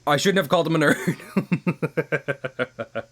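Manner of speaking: mock regret. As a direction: drag it out in mock guilt. Slow rueful put-on voice.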